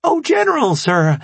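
high, flustered yelp; startled. Acted. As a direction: jump at the tap. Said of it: "the woman jumped".

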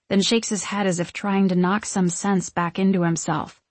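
ordinary delivery, nothing marked

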